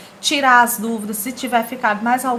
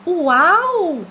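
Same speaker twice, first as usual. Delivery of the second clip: higher, louder gasp of wonder; amazed wow; 8 semitones higher, 7 dB louder than usual. Acted. Teacher voicing a comic character, wowed and louder. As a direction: blurt it out in awe.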